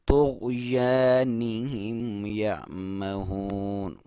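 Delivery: slow, drawn-out tajweed chant; slow Quran recitation. Shown as a demonstration. Teacher reciting the verse for students, slow and drawn out. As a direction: recite it slowly, stretching each vowel. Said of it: "तकरार तो गो नहीं करना".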